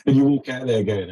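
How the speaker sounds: ordinary talk, nothing marked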